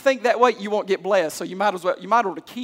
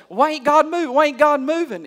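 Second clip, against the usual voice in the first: higher, louder whiny complaint; mock complaining churchgoer; 6 semitones higher, 4 dB louder than usual. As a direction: play the grumbling member of the congregation. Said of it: "that's the reason why you say".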